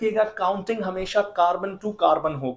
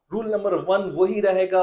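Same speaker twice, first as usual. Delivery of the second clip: ordinary talk, nothing marked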